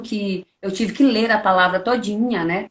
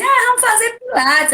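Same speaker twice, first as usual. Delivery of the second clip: mocking high invitation; 12 semitones higher than usual. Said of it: "me disseram assim".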